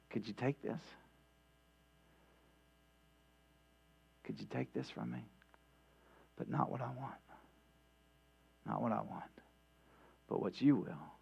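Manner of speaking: soft, hushed prayer of surrender; tender plea. Retold or imitated. Jesus praying to his Father, hushed and yielding. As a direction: pray it softly, yielding.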